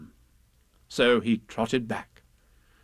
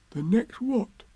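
Pooh's puzzled question; 10 semitones higher, slower than usual. Acted. Puzzled Pooh asks Piglet.

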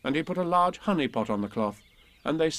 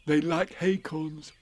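slow, lightly voiced bear, slightly higher; Pooh; 4 semitones higher, slower than usual. A narrator's reading. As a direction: explain slowly as a storybook bear. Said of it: "said Pooh".

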